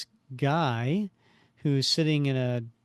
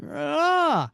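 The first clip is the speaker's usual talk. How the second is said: startled yell; 13 semitones higher, 3 dB louder than usual. Acted. Thug thrown up to beam.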